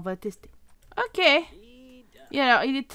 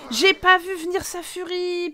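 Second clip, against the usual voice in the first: high, loud, slow lament; dismayed protest; 5 semitones higher, 6 dB louder than usual. Real, not acted. Player who didn't see the fury coming, dismayed protest. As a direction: draw out the dismayed complaint.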